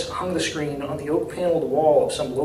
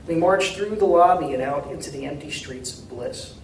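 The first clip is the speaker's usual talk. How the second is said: plain reading voice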